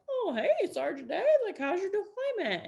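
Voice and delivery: high-pitched mock chummy greeting; falsely friendly commander. Wife imitating the commander greeting her deployed husband, mock-friendly. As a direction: imitate an over-cheery boss on the phone.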